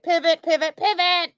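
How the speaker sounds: high, excited shouting of pivot; hammed-up Ross yelling at his friends; Ross moving the couch. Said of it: "Ross on Friends".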